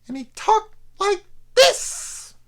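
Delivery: slow, very high cartoon henchman impression; Roger Rabbit villain voice. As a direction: ham up a squeaky, slow cartoon baddie. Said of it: "talking like the villain in Roger Rabbit".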